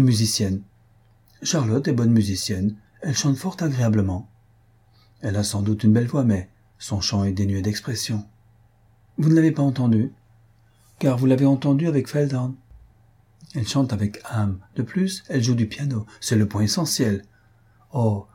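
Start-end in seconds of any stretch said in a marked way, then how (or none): none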